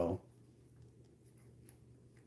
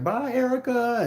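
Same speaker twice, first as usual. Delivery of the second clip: mock farewell; 13 semitones higher than usual. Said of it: "he's like".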